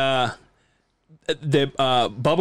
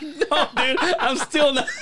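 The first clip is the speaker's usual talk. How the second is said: laughing refusal; 12 semitones higher, slower than usual. Retold or imitated. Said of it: "I'm like".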